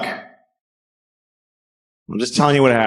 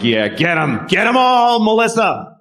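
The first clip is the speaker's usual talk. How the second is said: gleeful cheer; 12 semitones higher than usual. Pretend. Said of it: "I'm like".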